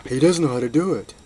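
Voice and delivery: put-on high, loud tattling kid voice; mocking child. Mocking kid tells mommy about the cuber, high loud tattling.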